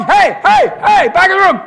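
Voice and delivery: high, loud shout; calling out. Real, not acted. Preacher shouting to wake the congregation. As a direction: shout to grab the room.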